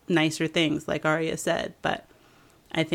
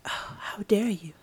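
hushed scandalized whisper, higher; mock offended whisper; 4 semitones higher than usual. S1 voicing Sansa offended by Arya, hushed whisper. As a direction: gasp out mock outrage. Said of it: "And she's like".

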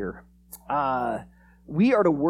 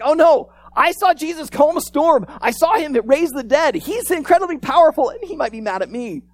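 high, loud, alarmed rush of worry; hammed-up frightened disciple; mock panic; 9 semitones higher, 7 dB louder than usual. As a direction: pile up the reasons to panic, easing off at the end. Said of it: "if anyone should have fear".

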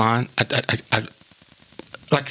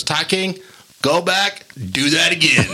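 calling from another room; Adam; 6 semitones higher, slower than usual. Retold or imitated. Director sending actor back for another take, calling. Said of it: "from another room".

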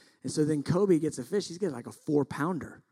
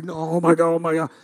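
louder, breathless-style repeated exclaiming; mock freak-out over a catch; flipping out; 3 semitones higher, 8 dB louder, faster than usual. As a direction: imitate the guide too thrilled to listen. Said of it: "The dude is flipping out".

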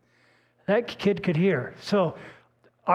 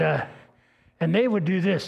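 ordinary delivery

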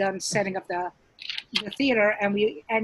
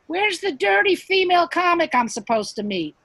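higher, louder call from offstage; calling down the hallway; 8 semitones higher, 5 dB louder than usual. Retold or imitated. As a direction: shout it down the corridor.

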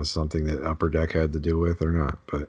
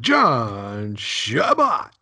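higher, louder, very slow drawn-out announcer call; arena announcer; 5 semitones higher, 4 dB louder, much slower than usual. Retold or imitated. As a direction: stretch the name out like a hockey arena announcer. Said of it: "the arena announcer".

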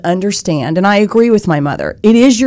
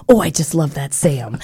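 put-on lower, softer Midwestern accent; fond imitation of a Midwestern board chair's accent; quoting an old mentor in a gentle Midwestern accent; 4 semitones lower, 4 dB quieter than usual. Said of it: "she would say in her Midwestern accent".

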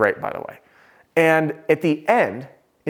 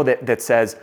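usual speaking voice

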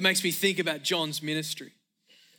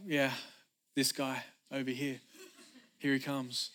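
low, soft offhand mumble; underplay it, barely bothering to announce him; casual, unbothered; 5 semitones lower, 7 dB quieter than usual. Acted. Preacher imagining John with hands in pockets, nonchalant. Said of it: "put his hands in his pockets and just was like".

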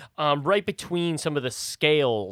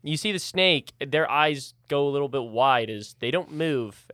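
ordinary talk

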